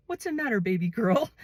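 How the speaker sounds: high, sweet caring call; mothering a scared newcomer; kind big woman. Retold or imitated. Big woman voiced kindly.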